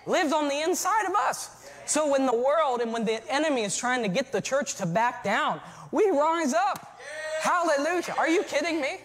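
loud, high preacher shout; full-force preaching. Real, not acted. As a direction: preach it out with rising fire.

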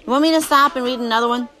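usual speaking voice

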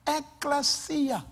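said slowly and clearly; slow, careful. Shown as a demonstration. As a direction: spell it out slowly with the lips. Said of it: "read my lips".

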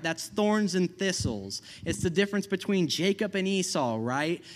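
usual speaking voice

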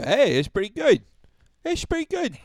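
lightly put-on Baltimore accent, high and loud; a hint of a hammed-up Baltimore accent. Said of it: "I'm out of practice with the fucking voice".

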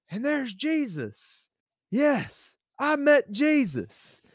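high, drawn-out mock reverence; hammed-up wonder, slow and high-pitched; mock awe. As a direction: marvel theatrically, stretching it out high. Acting out awe at meeting Jesus.

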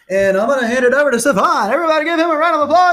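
loud, high-pitched announcer shout; hyped announcer. Pretend. Host hands over to his co-host, hyped announcer shout. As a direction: whip up the crowd with a big high yell.

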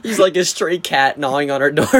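normal talking voice

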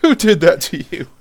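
loud, high feigned shock; mock outrage. Teasing the friend he dragged to the film, mock outrage.

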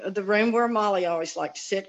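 normal talking voice

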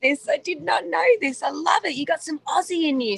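high-pitched, thrilled discovery; delighted surprise. Real, not acted. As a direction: react with real, delighted astonishment. Learns he has Australian family.

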